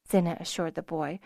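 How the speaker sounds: ordinary delivery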